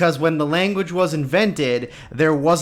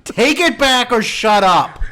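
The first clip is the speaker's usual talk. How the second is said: mock shout; 7 semitones higher, 5 dB louder, slower than usual. Mock aggressive racist rant.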